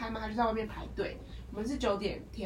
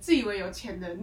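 ordinary talk

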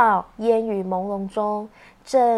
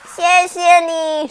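high, slow grateful thanks; higher child voice; 5 semitones higher, 7 dB louder, slower than usual. Acted. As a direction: say it sweetly, higher. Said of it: "互相说".

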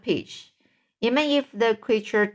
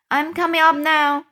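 higher and louder, called from below; calling up; 7 semitones higher, 5 dB louder than usual. Acted. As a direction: call it up from far down.

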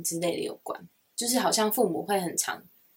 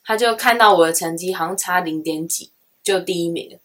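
normal talking voice

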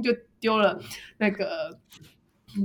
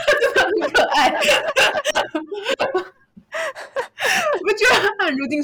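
quicker, high words through giggles; laughing fondly; 13 semitones higher, 5 dB louder than usual. Real, not acted. Telling her friend the coworker is adorable, laughing fondly. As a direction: gush about her, laughing.

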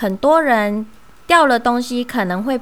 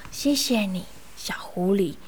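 soft, lower, half-whispered gratitude; heartfelt thanks; 4 semitones lower, 7 dB quieter than usual. Acted. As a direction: thank the rescuer with hushed relief. Relieved mouse thanks the fox.

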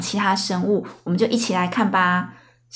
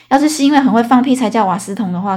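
ordinary talk

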